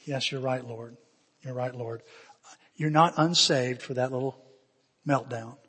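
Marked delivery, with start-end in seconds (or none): 0.0-2.0 low, hushed contrition; humble agreement. Retold or imitated. Man yielding to the Lord, humbled and quiet. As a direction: concede softly, trailing almost to nothing.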